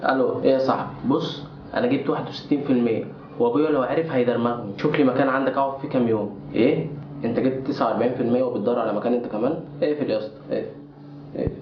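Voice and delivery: low, soft secret call to a friend; hushed phone call. Acted. Boy secretly calling his friend, hushed and low. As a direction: keep it down so dad can't hear.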